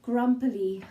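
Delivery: ordinary delivery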